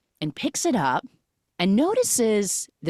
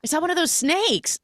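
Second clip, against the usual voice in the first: flustered; 9 semitones higher than usual. Retold or imitated. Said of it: "She's like".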